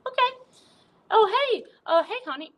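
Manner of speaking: very high put-on squeaky voice; banana character. Banana jumping in with the wrong line, high-pitched. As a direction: pipe up high, like a little puppet.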